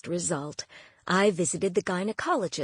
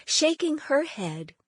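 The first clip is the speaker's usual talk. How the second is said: ordinary delivery, nothing marked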